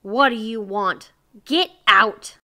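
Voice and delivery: loud gruff snap rising to a shout. A narrator's reading. Ashamed miser to nosy neighbors, gruff. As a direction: bark it gruffly to drive them off. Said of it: "said gruffly".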